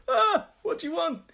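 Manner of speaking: high-pitched mock reply from a relative; startled family member. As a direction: answer high, as if called from another room. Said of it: "one of my family will".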